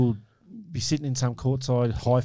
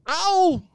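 mock ow; 3 dB louder than usual. Reacts to painful waxing joke.

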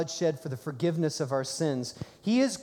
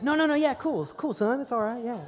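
forced casual okay; 6 semitones higher than usual. Retold or imitated. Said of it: "I was like".

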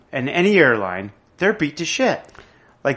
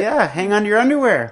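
higher, breezy, chummy pilot announcement; casual laid-back pilot; 7 semitones higher than usual. Retold or imitated. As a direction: talk to passengers like buddies, pitched up. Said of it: "why don't you just say".

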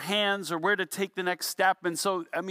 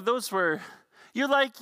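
ordinary delivery

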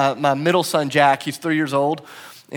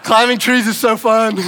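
put-on higher, louder, gleeful toddler voice; delighted little boy; 5 semitones higher, 5 dB louder than usual. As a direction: bubble over like a kid who just won. Tearful son delighted after climbing.

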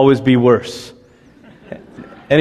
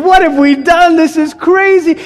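hammed-up frantic family; frantic exasperation; 12 semitones higher than usual. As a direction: wail it like the house is chaos. Family overwhelmed by the animals.

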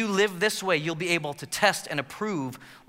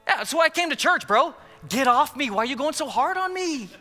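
high, defensive whine; 9 semitones higher than usual. Retold or imitated. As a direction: protest like a churchgoer being pushed. Defensive churchgoer voiced.

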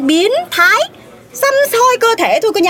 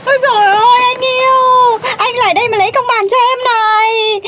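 put-on whiny wail to boyfriend; 3 semitones higher than usual. Girlfriend demands justice from her newly arrived boyfriend, whiny wailing. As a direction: wail to your darling for justice.

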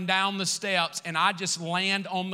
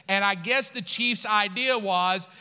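ordinary talk, nothing marked

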